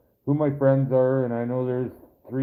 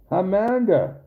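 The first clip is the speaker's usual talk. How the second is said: excited greeting; 9 semitones higher, 3 dB louder, slower than usual. Real, not acted. Greeting a viewer who just arrived.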